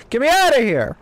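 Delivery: loud high panicked scream; screaming while falling. Real, not acted. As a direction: scream as you fall. Falling into a cave.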